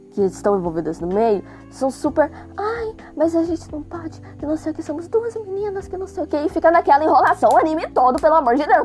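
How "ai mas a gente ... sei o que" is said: high, soft, mocking girly whine; whiny schoolgirl voice. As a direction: mimic the shy girls whining. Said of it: "a maioria das meninas ali, são super".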